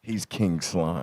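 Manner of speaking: slow, low villain intonation; mock-sinister anime villain. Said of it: "making him sound like an anime villain".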